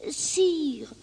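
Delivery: soft, high address to a king; high, hushed and deferential; meek lamb. Acted. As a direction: plead softly as a small lamb. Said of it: "répond l'agneau".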